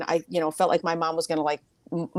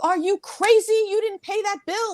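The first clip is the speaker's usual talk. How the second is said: high-pitched scolding of a loved one; acted-out indignant reproach; mock outrage; 12 semitones higher, faster than usual. Voicing an upset loved one.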